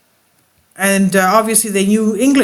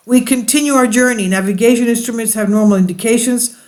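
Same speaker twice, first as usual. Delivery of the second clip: ordinary talk, nothing marked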